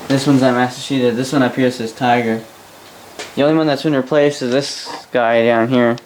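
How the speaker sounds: ordinary talk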